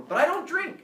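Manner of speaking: high, loud mock whine; protesting innocence. Student to cops who found beer in his car, high-pitched protest.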